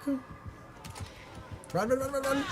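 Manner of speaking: urgent high yell; frantic urging to run; yelling while running away. Real, not acted. Urging self while fleeing.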